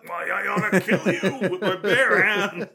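loud, high, hammed-up rage; mock threatening. Listener jokingly acts enraged denier.

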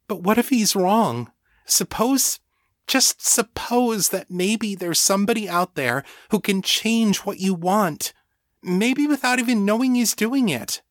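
high, urgent, tentative voice; pleading friend Rebecca. A narrator's reading. Rebecca urging Danny to doubt the wizard, pleading. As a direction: reason with her, pitched well up.

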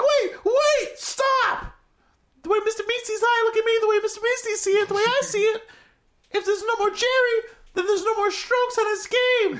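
high, eager cartoon helper voice, laughing through it; Mister Meeksy voice. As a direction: squeak it up like a chipper little helper who exists to serve. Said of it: "the one guy's like".

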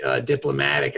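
ordinary talk, nothing marked

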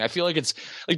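ordinary talk, nothing marked

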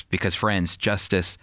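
ordinary talk

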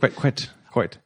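clipped, posh British "quite". Retold or imitated. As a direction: say "quite" like a proper Brit. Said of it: "Also very British".

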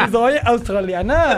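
slow, drawn out through laughter; slow giggly mock quote; laughing slow quote. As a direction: quote her while cracking up. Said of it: "Y dice que".

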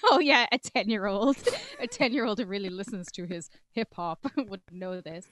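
amused, laughter breaking into the words; laughing. Real, not acted. Co-host mocking the rap idea for a ten-year-old, laughing. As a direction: talk through real laughter.

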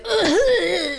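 mock breathless gasp; gasping for air. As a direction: gasp like you're winded. Retelling running for the bus.

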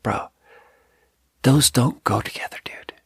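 a blunt private aside, whispered. Retold or imitated. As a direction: whisper it bluntly under your breath. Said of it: "I don't say it like that".

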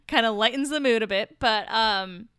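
normal talking voice

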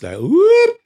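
sharp upward swooping whoop; quick high rising whoop. Shown as a demonstration. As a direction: swoop the voice up fast. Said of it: "the rate of acceleration of the voice".

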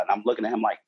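normal talking voice

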